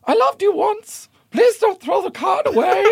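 put-on high, loud ghostly plea in a slight German accent; wailing ghost with a slight German accent. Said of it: "The slight German accent".

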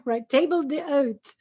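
slow, hammed-up French accent on Table d'hôte; bad French accent. Shown as a demonstration. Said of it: "That's a really bad French accent".

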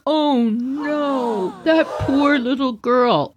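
put-on high, pitying cluck over the little girl; lightly voiced sympathetic onlooker; pitying crowd. Crowd pitying the fallen girl, high mock sympathy. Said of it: "Everybody else is like".

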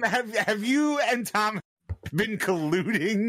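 high, slow accusing question; mock suspicious. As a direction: drag it out like a suspicious detective.

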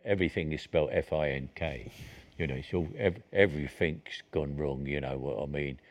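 low, soft Cockney accent demo; lightly put-on Cockney, dropping toward a mumble. Shown as a demonstration. Caine demonstrates Cockney for the interviewer, low Cockney accent. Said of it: "Cockney is very serious like that".

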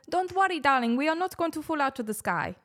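put-on high, rough go at a French accent; slightly shaky attempt at French-accented English. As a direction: imitate a French air hostess soothing a passenger. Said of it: "That was a French accent".

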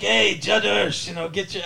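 Danny DeVito voice. Retold or imitated. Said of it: "I was attempting a Danny DeVito impression".

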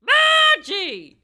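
high, loud, drawn-out name call; mock scream. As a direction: holler the name like a superhero summons.

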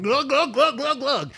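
high gulping noises; glug sounds. Retold or imitated. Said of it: "Ted Cruz goes".

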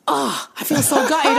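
hammed-up crying whine, odd voice; mock gutted whine. Friend moaning to Odessa about Ghanaians, in a mock cry. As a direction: fake a sob as if heartbroken. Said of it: "And he's like".

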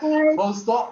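laughing through the words; amused laugh over the phrase. Real, not acted. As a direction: giggles out the words, high.

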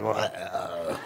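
very low, soft demonic voice; possessed kid. Retold or imitated. Possessed kid turning its head, deep demon voice. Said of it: "they're like".

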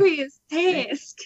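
slow "Dubious task" with playful exaggerated stress; odd emphasis. Shown as a demonstration. Echoing a teasing friend, drawn-out "Dubious task". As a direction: stretch "Dubious task", amused at yourself. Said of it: "you put the emphasis on the wrong syllables".

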